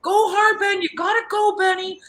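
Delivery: high, loud shout of encouragement; urging cheer. Retold or imitated. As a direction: yell them on like an excited fan. Acted cheering on of Benny.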